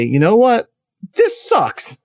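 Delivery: mock blunt complaint. Guest voicing the taboo complaint, a high exasperated outburst. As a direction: blurt it like you're finally fed up. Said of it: "to say".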